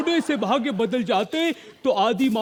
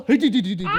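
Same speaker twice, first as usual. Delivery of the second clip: sheepish giggle; faster than usual. Acted. Answering the superhero sheepishly.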